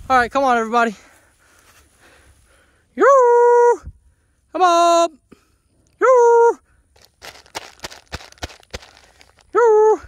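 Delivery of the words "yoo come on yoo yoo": high, loud calls across the field; long high yell to the cows; calling out. Real, not acted. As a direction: holler to the herd. Calling cows to run past.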